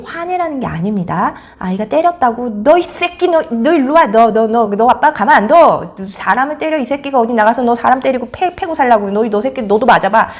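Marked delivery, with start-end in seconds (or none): 2.6-10.3 loud, repetitive acted parental rant; hammed-up furious scolding; angry outburst. Shown as a demonstration. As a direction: rage at the child, stumbling over "너". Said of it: "이렇게 화내시라는 게 아니에요".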